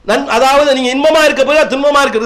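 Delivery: usual speaking voice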